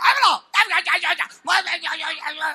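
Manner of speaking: high, loud mock tirade; married-couple squabble. Spouse after the wedding scolds the other, shouted mock tirade. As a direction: yell a rapid, garbled harangue. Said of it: "Sudah menikah".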